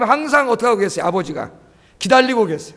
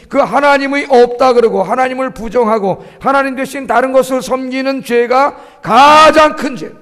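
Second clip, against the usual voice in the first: building, loud sermon emphasis; emphatic preaching; 3 semitones higher, 5 dB louder than usual. Real, not acted. Preacher names denying God the greatest sin, emphatic preaching. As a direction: drive the point home, stretching key words.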